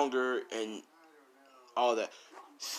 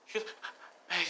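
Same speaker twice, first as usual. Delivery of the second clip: ordinary delivery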